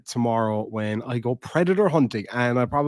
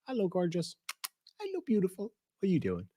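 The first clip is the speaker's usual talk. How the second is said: pet talk; 9 semitones higher, 9 dB quieter, faster than usual. Real, not acted. Greeting his dog softly.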